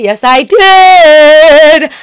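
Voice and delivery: loud slow proud yes. Real, not acted. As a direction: shout it out slowly and proudly.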